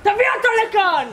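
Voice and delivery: very loud command; shouted order. Acted. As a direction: bellow the order. Officer orders soldier brought.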